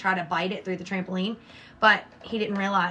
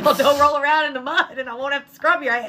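mock scolding; 5 semitones higher, 5 dB louder than usual. Retold scolding of shaking dog.

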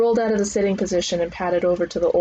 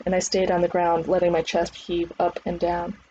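plain reading voice

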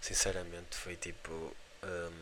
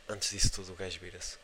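ordinary delivery

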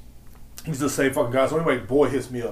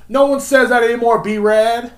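high, loud scolding outburst; mock exasperated yell; 9 semitones higher, 8 dB louder, slower than usual. Podcaster telling off the slang-talking wannabe, exasperated yell. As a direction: shout it down in mock outrage. Yells rebuke at the imitated wannabe.

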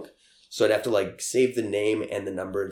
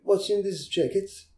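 higher, lightly voiced foreign girl; imitating broken English; 10 semitones higher than usual. Quoting the Brazilian girl, lightly mimicked. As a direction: repeats her line in a mock accent. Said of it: "spoke broken English".

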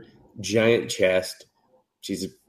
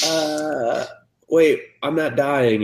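mock surprise; 6 semitones higher, slower than usual. Boy surprised in recap.

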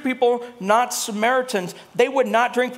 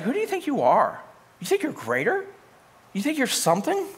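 rising, higher-pitched mock disbelief; incredulous challenge; 5 semitones higher than usual. Samaritan woman asks Jesus who he thinks he is, incredulous. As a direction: challenge him with sceptical questions, lifting each ending.

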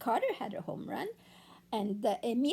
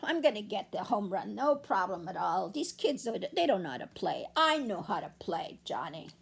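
cocky Johnny; 7 semitones lower than usual. Acted. Johnny boasting before batting, lower and smug. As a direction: brag like a know-it-all kid. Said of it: "Johnny is all sure of himself".

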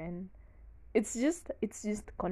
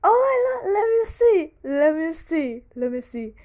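loud and high, laughing through the words; can't stop laughing; laughing fit; 8 semitones higher, 13 dB louder than usual. Real, not acted. Trying to talk to viewers through laughter.

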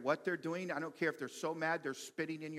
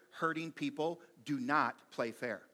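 ordinary talk

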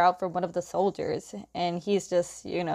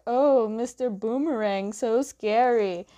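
mock-impressed, higher and drawn out at the end; sarcastic; 3 semitones higher than usual. Protester taunts Sokka about his boomerang, sarcastic. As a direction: feign awe, stretching the taunt. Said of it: "a guy being sarcastic".

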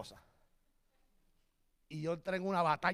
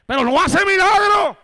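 loud, high, drawn-out declaration; emphatic shouted sermon delivery; full-force preaching; 11 semitones higher, 21 dB louder, slower than usual. Real, not acted. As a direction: proclaim it to the whole church at a shout.

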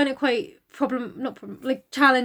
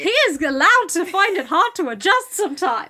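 passionate defence; 3 semitones higher, 4 dB louder than usual. Acted. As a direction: defend him with feeling.

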